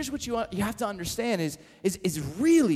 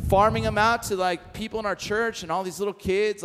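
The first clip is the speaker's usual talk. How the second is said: normal talking voice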